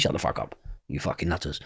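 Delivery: low, hushed swearing; quiet, scornful retort; muttered dismissal. Retold or imitated. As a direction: mutter it under your breath. Retells dismissing the brothers.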